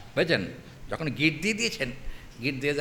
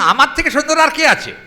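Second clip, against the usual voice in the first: proud boast; 11 semitones higher, 10 dB louder than usual. Acted. Krishna boasts of his beauty.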